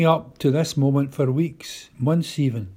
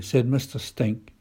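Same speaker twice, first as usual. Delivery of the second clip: ordinary delivery, nothing marked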